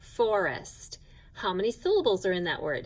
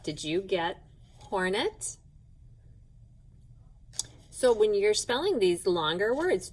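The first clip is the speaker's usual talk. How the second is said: usual speaking voice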